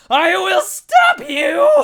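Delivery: very high, loud, slow shriek then laugh; shrieking sorcerer. Acted. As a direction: screech it like a mad wizard.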